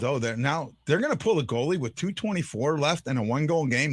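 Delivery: ordinary delivery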